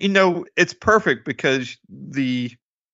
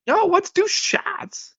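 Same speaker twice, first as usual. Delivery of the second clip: much higher, very slow, drawn-out Walken voice; Walken impression; much slower than usual. [name] pushing shots on Emma, slow high Walken imitation. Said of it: "He's doing a Walken impression".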